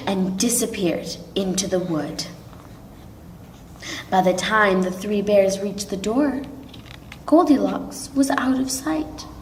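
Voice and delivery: plain reading voice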